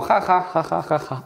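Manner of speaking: flat, deadpan ha ha ha, fading out; a forced laugh with no joy in it; hollow polite laughter fading to nothing; dry fake laugh. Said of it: "Yo hago como".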